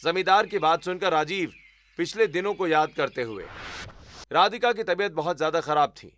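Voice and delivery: ordinary delivery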